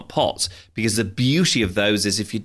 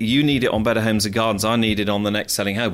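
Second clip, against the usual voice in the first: normal talking voice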